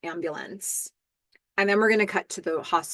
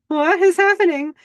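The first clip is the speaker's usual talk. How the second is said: much higher and louder, bewildered; high, loud flustered question; panicked; 10 semitones higher, 8 dB louder than usual. Acted. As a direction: blurt it out in alarm. Warren panicking at her advance.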